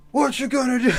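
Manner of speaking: hushed strained wrestler shout; Hulk Hogan whisper yell. Retold or imitated. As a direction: imitate a timid wrestling promo. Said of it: "Exactly like that".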